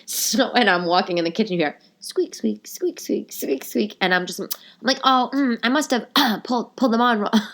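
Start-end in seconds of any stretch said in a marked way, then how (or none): 2.3-4.0 high, soft little squeaks; imitated squeaking. Walking in a silent kitchen, squeaky imitation. 5.0-7.4 high, faltering cover-up; embarrassed excuse. Retold or imitated. Girlfriend explaining squeak to boyfriend, embarrassed.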